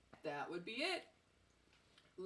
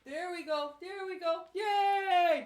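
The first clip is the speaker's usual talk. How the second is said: high, loud cheer for the dog; excited praise; 9 semitones higher, 10 dB louder, much slower than usual. Real, not acted. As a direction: cheer the dog on, rising to a shout.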